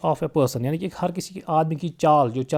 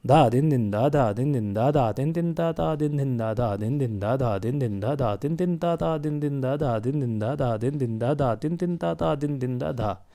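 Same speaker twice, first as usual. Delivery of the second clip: rapid, unbroken run of tabla bols; fast drut recitation. Shown as a demonstration. Teacher showing students fast laya in teen taal, drut recitation. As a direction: rattle off the syllables evenly at speed. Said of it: "यानी fast लय।".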